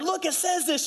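high, eager urging; excited insistence. Retold or imitated. Husband showing his crying wife the Bible, excited. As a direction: press the proof on them, pitched high.

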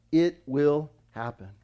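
"It will happen" in slow, separate words; emphatic. Retold or imitated. Preacher drawing attention to Jesus' exact words, emphatic. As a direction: give IT, WILL and HAPPEN each its own beat. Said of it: "Notice what?".

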